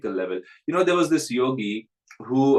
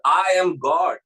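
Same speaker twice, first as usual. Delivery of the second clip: a louder, proud pronouncement of "I am God"; boastful claim; 4 semitones higher, 5 dB louder, slower than usual. Retold or imitated. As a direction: announce it to impress the guest. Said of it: "as if to impress him".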